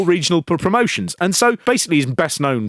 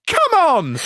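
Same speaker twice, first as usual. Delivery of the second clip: mock taunt. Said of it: "And then he goes".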